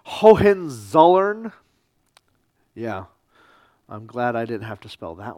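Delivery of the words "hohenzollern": Hohenzollern sounded out slowly and loudly; a careful, drawn-out try at Hohenzollern; careful attempt. Real, not acted. Lecturer attempting a hard German name, Hohenzollern said slowly and carefully. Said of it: "I'm gonna mispronounce this".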